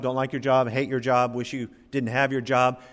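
ordinary talk, nothing marked

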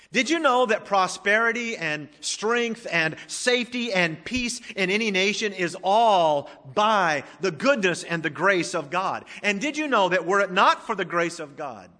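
fervent sermon climax; preaching at full force. Acted. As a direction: drive each question home to the congregation. Preaching build about God's grace.

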